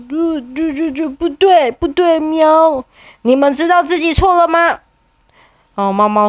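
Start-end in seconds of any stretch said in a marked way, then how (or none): 0.1-4.8 stammering cat. Acted. 猫猫结巴认错.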